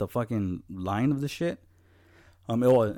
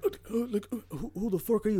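high, fast, stumbling retort; mock rattled stammer; 11 semitones higher, 3 dB quieter, faster than usual. Rattled man confronts dad in story.

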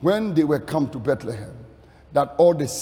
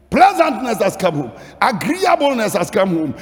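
high, loud, chant-like sermon building to a shout; full-force preaching; 10 semitones higher, 7 dB louder than usual. Acted. Preacher proclaiming pleasantness has come home, full-force preaching. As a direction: pile up each phrase, rousing the congregation.

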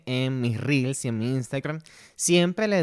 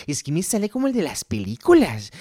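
ordinary talk

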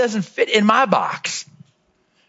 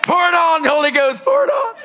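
cheering shout; 10 semitones higher, slower than usual. Retold or imitated. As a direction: shout encouragement at the crying friend. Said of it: "I'm like".

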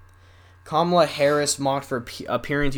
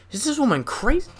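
higher, exaggerated disbelieving question; incredulous mock disbelief; 10 semitones higher than usual. Real, not acted. Vlogger mocking the Vice President's accent, mock disbelief. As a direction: ham up the shock at her accent.

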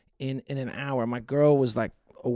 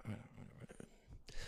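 fading resignation; 6 semitones lower, 8 dB quieter, faster than usual. Retold or imitated. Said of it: "Now I'm like".

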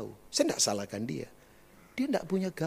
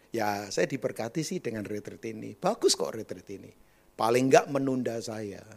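put-on low, matter-of-fact young man; emotionless; 5 semitones lower than usual. A young man giving retreat testimony, emotionless. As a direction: answer with no emotion at all. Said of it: "nggak ada emosi".

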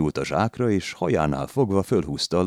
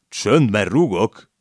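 loud, slow, higher threat; shout; 6 semitones higher, 7 dB louder, slower than usual. A narrator's reading. Lad on the sack shouts at the landlady, loud and slow. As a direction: bark it slowly across the room. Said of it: "kiáltott közbe a hintás legény".

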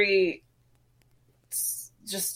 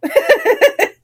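high, loud burst of laughter; 10 dB louder than usual. Real, not acted. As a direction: laugh hard at the punchline. Laughing at "You" punchline.

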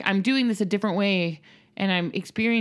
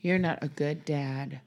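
put-on child's taunt; much slower than usual. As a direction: say it slowly like a little girl scolding. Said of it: "Emmeline has started walking around going".

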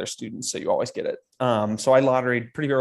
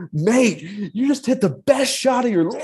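much higher and louder, thrilled; excited shout; 11 semitones higher, 4 dB louder than usual. Retold or imitated. South London golfer shaking him by the shoulders, excited shout. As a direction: shout it like he can't believe the shot. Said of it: "He goes".

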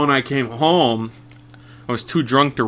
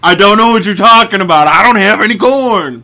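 loud denial; 8 semitones higher, 10 dB louder than usual. Pretend. Mock indignant denial.

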